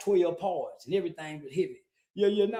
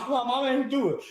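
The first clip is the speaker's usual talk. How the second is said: put-on little boy crying; 4 semitones higher, 7 dB louder than usual. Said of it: "I turned into a little boy".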